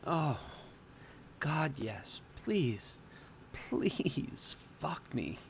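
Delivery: high, breathy desperate plea; begging. A narrator's reading. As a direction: plead softly, stretching out the last words. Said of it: "I begged from underneath her".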